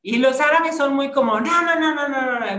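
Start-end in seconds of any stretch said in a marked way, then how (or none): 1.4-2.6 loud, high mock outburst of no's; hammed-up explosive no, no, no; explosive refusal. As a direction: fire off the no's like an exploding temper. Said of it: "muy así explosivos".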